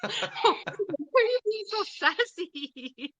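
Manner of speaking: high, slow, laughing through the words; laughing tease. Real, not acted. Laughing at the cheeky spirit box, high and slow. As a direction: giggle it out, amused and teasing.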